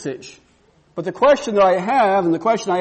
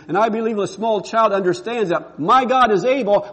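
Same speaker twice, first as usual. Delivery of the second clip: usual speaking voice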